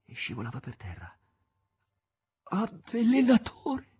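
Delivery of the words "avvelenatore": high, slow, strained cry; dying cry. Acted. Master accusing Azazello as he dies, high and strained. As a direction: cry out with the last strength. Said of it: "a gridare il maestro".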